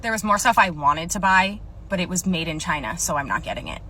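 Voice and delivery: usual speaking voice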